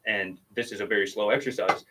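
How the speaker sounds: ordinary delivery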